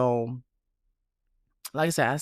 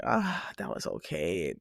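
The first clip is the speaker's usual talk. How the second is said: unimpressed; 6 semitones lower, 6 dB quieter than usual. Retold or imitated. Unimpressed verdict on a song.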